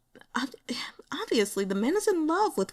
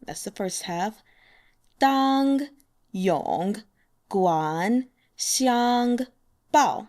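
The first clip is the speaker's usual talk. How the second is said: "滴水 之 恩 当永 光相 报" spaced out, each syllable held; very slow, one syllable at a time: "滴水 之 恩"; 4 semitones higher, 4 dB louder, much slower than usual. Shown as a demonstration. 一个字一个字慢慢地说. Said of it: "So slowly".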